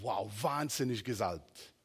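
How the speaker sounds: ordinary delivery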